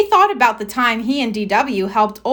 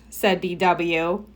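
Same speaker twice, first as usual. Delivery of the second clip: ordinary delivery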